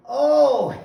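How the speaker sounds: very high, loud imitated "oh"; loud retold realization. Promoter learning that man is the attorney, loud realization.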